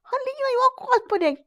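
squeaky little puppet calling to the audience; high puppet voice. Acted. Puppet compares prince to duvet.

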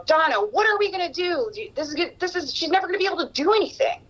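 imitating her frantic father arguing in the kitchen; worried dad. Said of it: "I overheard my dad say".

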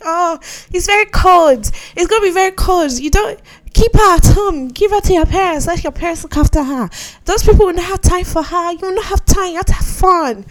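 high, loud mock busybody; nagging outsiders. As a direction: pile on the warnings, high-pitched and insistent. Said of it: "external people were like".

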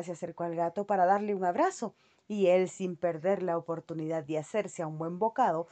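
usual speaking voice

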